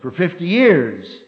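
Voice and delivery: loud, high insurance sermon; full-force preaching. Acted. Preacher driving home insurance point, loud preaching. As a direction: press the point hard to the hall.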